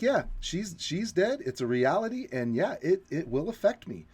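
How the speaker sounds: high mocking voice for a blunt admission; mock Neil. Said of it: "he's like".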